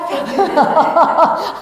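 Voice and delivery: high, loud laughter. Real, not acted. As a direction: keep laughing. Laughing continues.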